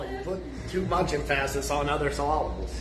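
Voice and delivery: emphasis as em-FASS-is, syllables as suh-LAH-buls; playful em-FASS-is for emphasis, suh-LAH-buls for syllables; jokey wrong stress. Pretend. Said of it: "put the emphasis on the wrong syllable".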